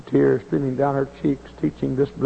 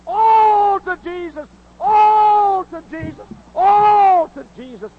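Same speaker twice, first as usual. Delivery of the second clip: much higher and louder, slow drawn-out cry; climactic shout; 6 dB louder, much slower than usual. Real, not acted. Preacher crying out surrender to Jesus, slow and much louder. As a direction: stretch each all into a shout.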